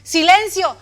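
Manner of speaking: loud, high commanding shout; powerful voice. Acted. Jesus silencing the wind, a powerful shout. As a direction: boom it out to stop the wind. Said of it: "viene una voz poderosa y le dice".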